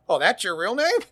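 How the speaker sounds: high, loud amused surprise; surprised exclamation. Retold or imitated. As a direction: blurt it out, caught off guard and amused. Ex-FBI man surprised, everyone laughing.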